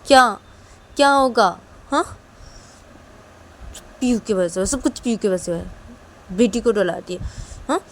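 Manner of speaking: loud, heated blaming; angry complaint. Acted. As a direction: vent loudly about who scared the child. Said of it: "बहुत गुस्सा आ रहा है मुझे".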